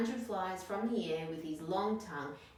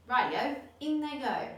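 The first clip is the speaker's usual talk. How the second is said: much higher, louder glee; cheery dingo; 7 semitones higher, 8 dB louder than usual. Acted. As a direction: shout it out happily.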